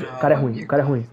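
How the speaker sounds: normal talking voice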